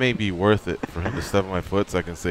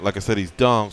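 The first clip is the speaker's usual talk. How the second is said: normal talking voice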